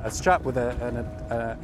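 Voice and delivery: usual speaking voice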